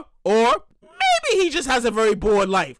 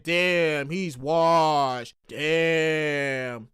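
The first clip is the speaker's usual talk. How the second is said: low mock pity; 10 semitones lower, much slower than usual. Everybody gonna be like.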